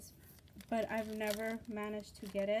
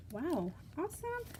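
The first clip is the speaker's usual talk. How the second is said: higher, rising wow awesome; delighted wow; 6 semitones higher than usual. Real, not acted. Flipping through pages for viewers, delighted and excited. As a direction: marvel at the page.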